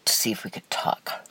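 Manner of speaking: trailing off into a real whisper; breathy whisper. Real, not acted. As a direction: let the words slip out in a whisper. Voice drops while recalling ex.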